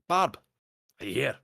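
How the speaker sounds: loud, high call across the house. Real, not acted. Speaker breaks off to find Barb, calling out loudly. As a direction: shout to find out if someone is there. Calls out to someone elsewhere.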